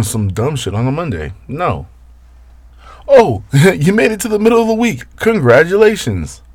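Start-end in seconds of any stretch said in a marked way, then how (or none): 3.1-6.4 high, hammed-up cheering with a laugh; mock congratulations. Host congratulating listeners on midweek, mock celebration. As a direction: congratulate them in a much higher mock voice.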